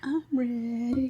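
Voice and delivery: hushed little whisper. Acted. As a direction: whisper it softly. Whispered answer to host.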